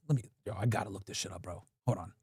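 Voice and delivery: low, soft, fast mutter to himself; mumbled aside. Real, not acted. Streamer to himself while searching the web, a fast low mutter. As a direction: talk under the breath while searching.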